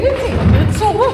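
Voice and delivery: loud, high, fast demand to give it back; snatch it back, shouting; angry demand. Acted. Streit um den Stoff. (wütend fordernd, laut und schnell)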